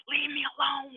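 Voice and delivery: lightly imitated upset brother yelling; mild mock yell. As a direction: push her away with a restrained mock yell. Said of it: "he's yelling at her".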